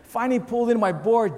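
ordinary delivery

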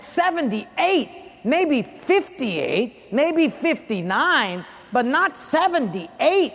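protesting to the officer, slow and incredulous. Retold or imitated. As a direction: haggle the number down in disbelief. Disputing speeding ticket with officer.

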